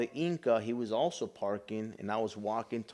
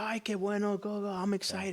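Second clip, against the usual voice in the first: higher, lightly voiced happy mother; a mom thrilled at the news; excited mom; 10 semitones higher than usual.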